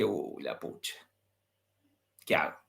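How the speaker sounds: pensamiento dicho muy bajo y grave; susurro para sí. Retold or imitated. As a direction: como hablándose por dentro, preocupado. Pensamiento propio, apurado ante la mujer. (en voz muy baja, casi para adentro)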